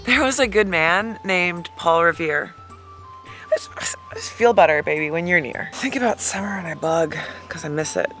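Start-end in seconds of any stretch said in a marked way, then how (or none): none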